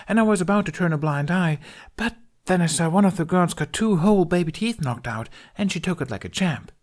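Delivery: high, fast, gushing babble; rapid excited chatter. A narrator's reading. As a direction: pelt them with words. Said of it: "She was talking so rapidly".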